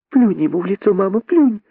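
put-on high, squeaky excited child voice; eager stage-whisper, high and voiced; joyful little monkey. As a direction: egg mum on gleefully in a tiny high voice. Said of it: "радостно прошептала мартышка-дочка".

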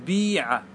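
بيع with a long "ee" after the b; kasra on the first letter. Shown as a demonstration. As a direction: say بيع as "bee-'a", pure kasra. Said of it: "واكسر".

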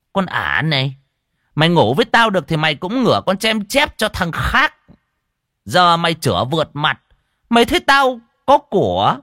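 high, loud angry tirade; furious scorn. A narrator's reading. Rich man casts out his pregnant lover, furious and contemptuous. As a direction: berate and throw her out, rising to a yell.

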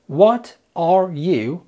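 What are you, each word drawn out slowly; slow separated What are you; very slow careful. Shown as a demonstration. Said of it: "لما بتتقال سريع".

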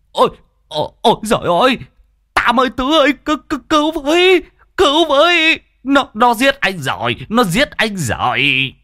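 high panicked yelps, stammering; frantic high-pitched comic cries for help; crying for help. A narrator's reading. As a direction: scream for rescue as if being killed. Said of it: "Nghe thằng cha Quyết kêu cứu".

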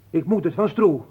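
high, forceful self-defence; loud protest. Acted. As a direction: shout the excuse out. Defends himself against the accusers.

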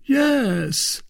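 a boastful musketeer, lifted high; Porthos voiced high. A narrator's reading.

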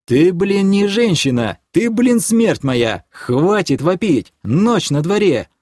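high, heated, emphatic rebuke; exasperated scolding. A narrator's reading. Man telling his girlfriend to stop yelling, exasperated.

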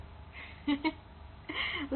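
breathy unvoiced laugh. Laughs at the recording.

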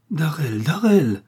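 high, alarmed cry of the name. A narrator's reading. Millicent cries out to stop Darrell, alarmed. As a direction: call his name twice, rising in pitch. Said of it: "s'écria Millicent".

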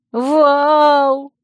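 high, loud desperate scream. Acted. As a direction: scream his name in terror. Girl screams friend's name.

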